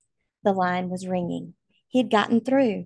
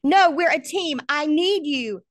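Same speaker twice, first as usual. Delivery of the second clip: anguished plea; 8 semitones higher, 6 dB louder than usual. Acted. Son refusing father's goodbye.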